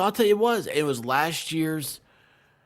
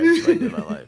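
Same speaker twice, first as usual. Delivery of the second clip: high, loud, laughing through the words; 9 dB louder, much faster than usual. Real, not acted. Cracking up while joking about his hobby, laughing loudly. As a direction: crack up as you repeat it.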